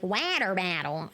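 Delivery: "Water battle" with flapped t's, "wanner bannel"; mock overcorrected American accent. Streamer mimics a Brit overcorrecting to American, mock "Water battle". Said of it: "water bottle in an American accent".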